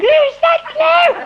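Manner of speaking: high, slow startled "who's that"; drawn-out surprised query at the door; mock alarm at the knock; startled call.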